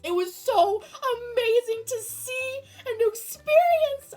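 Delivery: high and loud, thrilled; excited shouting. Real, not acted. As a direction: gush it out at full volume. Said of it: "Sorry for my incessant screaming".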